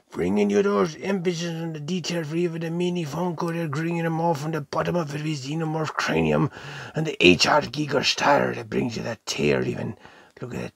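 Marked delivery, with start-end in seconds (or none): none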